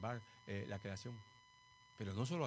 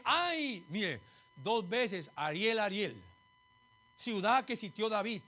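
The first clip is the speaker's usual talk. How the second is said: higher, forceful expository preaching; emphatic preaching; 7 semitones higher, 7 dB louder, slower than usual. Real, not acted. Preacher explaining the name Ariel to the congregation, emphatic preaching. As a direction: drive each word's meaning home, pitched up.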